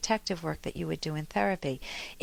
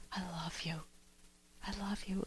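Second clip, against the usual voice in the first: breathy, hushed "I love you"; tender whisper; 13 dB quieter than usual. Shown as a demonstration. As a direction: whisper it in a lover's ear. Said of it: "in this wonderful, warm embrace".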